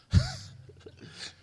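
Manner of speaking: a burst of unvoiced, high laughter; short airy laugh; high breathy laugh. Real, not acted. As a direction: laugh out at the joke. Laughing at his own punchline.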